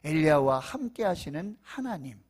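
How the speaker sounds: high, loud fervent preaching; preaching at full force. Real, not acted. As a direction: preach it out with rising fire.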